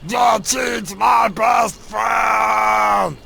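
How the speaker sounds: slow, dragged-out brute bellow; strongman. Acted. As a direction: bellow each word like a big oaf. Warlord bellows slowly.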